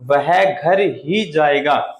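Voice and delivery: very slow, ही pressed; stress on घर ही. Shown as a demonstration. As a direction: insist on "घर ही". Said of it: "और कहीं नहीं जाएगा".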